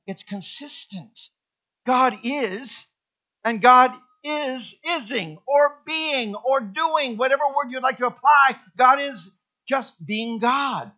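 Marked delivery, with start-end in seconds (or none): 1.8-10.9 loud, high, slow proclamation; rising preacher's declaration; preaching at full force. Acted. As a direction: proclaim it to the whole room. Preaching builds.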